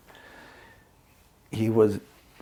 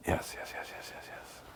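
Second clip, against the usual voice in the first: soft unvoiced whisper of yes, repeated; whispered yeses; 18 dB quieter than usual. Shown as a demonstration. Coaching a friend, demonstrating a whispered inner yes. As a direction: breathe the yeses almost silently. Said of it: "Another yes might be".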